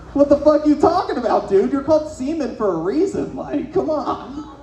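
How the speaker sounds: high, incredulous protest; mock exasperation. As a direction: scold in amused disbelief, stretching the last words. Coach scoffs at crying student.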